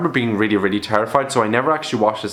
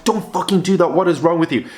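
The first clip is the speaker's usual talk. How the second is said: higher-pitched exasperated outburst at the screen; exasperated telling-off; 6 semitones higher than usual. Retold or imitated. Viewer talking back to a horror movie, exasperated. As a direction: scold the characters through the TV. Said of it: "made me speak to the T V".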